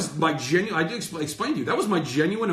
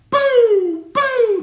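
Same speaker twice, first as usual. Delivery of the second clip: high, loud jeering boo, boo; mock booing; 9 dB louder than usual. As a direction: boo them like a hostile crowd online. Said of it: "That's just".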